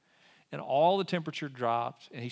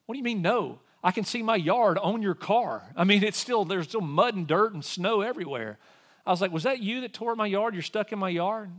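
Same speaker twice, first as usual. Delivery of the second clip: incredulous anger; 7 semitones higher, 4 dB louder than usual. Retold or imitated. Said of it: "I'm like".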